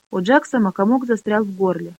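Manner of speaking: ordinary delivery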